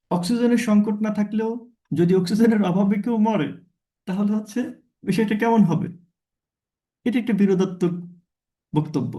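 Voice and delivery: usual speaking voice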